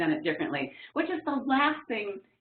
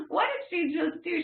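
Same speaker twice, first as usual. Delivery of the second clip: incredulous; 11 semitones higher than usual. Retold or imitated. Stunned by gal's interruption.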